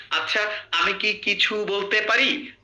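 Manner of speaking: আচ্ছা, আমি কি কিছু বলতে পারি said slowly with weight on each word; every word stressed. Shown as a demonstration. Teacher showing Bengali habit, even stress on every word. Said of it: "প্রত্যেকটা ওয়ার্ডে জোর দেওয়া".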